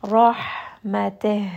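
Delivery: "Rahmateh" with a heavy ra and airy ha, slowly; tajweed demo; slow recited rahmateh. Shown as a demonstration. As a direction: give the ha plenty of air. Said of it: "a lot of air that is going to come".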